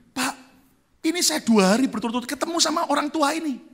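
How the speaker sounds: high, loud preaching at full force; fired-up preaching. Acted. Pastor telling the congregation a story, high and loud.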